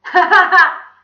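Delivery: loud, high giggly burst; child's laugh. A narrator's reading. Pupil laughing at the sneezing villain, gleeful. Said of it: "Todd laughed".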